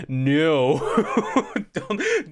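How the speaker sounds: cracking up mid-word; laughing. Real, not acted. Laughing at a crude phrase.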